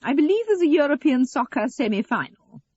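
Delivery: high, loud, affected announcement; martyred mother. A narrator's reading. Eleanor telling her daughter what's on television, martyred.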